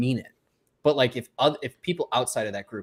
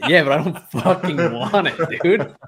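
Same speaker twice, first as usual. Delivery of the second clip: slower, louder, higher retelling of an irritated reply; annoyed retort; 5 semitones higher, 7 dB louder, slower than usual. Retold or imitated. Retelling his reply to the restaurant guy, mock irritated. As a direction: snap back at the waiter, half laughing. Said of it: "And I'm like".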